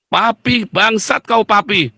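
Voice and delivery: put-on high-pitched child swearing at dad; cursing child. A child suddenly cursing his father, insolent. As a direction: hurl a child's sudden insult at Papi. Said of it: "Tiba-tiba anak bilang".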